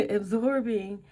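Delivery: laughing through the word. Real, not acted. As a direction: chuckle at the stumble. Laughs at own stumble.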